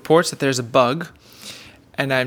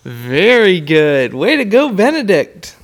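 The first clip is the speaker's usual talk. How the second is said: excited praise; 3 semitones higher, 6 dB louder, slower than usual. Real, not acted. Praising son after reading game.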